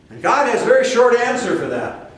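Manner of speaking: usual speaking voice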